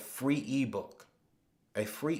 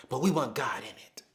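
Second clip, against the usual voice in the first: whisper; 3 semitones lower than usual. Real, not acted. To viewers about wanting God in it, whispered. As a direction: let it drop to a whisper as a confiding aside.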